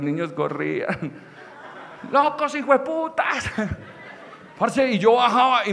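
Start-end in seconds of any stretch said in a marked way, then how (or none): 2.1-3.5 high, slow drawn-out insult; imitating a taunt called after someone; cousins' mock yelling. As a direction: stretch the insult out at a high pitch. Cousins yelling at fleeing children.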